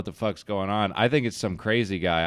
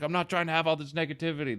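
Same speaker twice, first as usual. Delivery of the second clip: high bro voice; 7 semitones higher, 4 dB quieter, faster than usual. Retold or imitated. The rapper defends himself.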